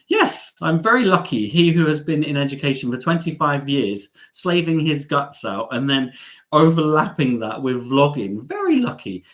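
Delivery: mock-indignant, heavy sarcasm with pitch swoops; real sarcastic comeback on being called lucky. Real, not acted. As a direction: pretend to agree, dripping with irony. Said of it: "That sounded so sarcastic. I am a very sarcastic person".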